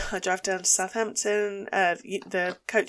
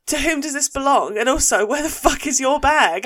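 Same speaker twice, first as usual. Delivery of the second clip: raised, louder mock-indignant questioning; exasperated demand; 5 semitones higher, 8 dB louder than usual. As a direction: re-enact an incredulous telling-off. Acts out confronting mum over bag.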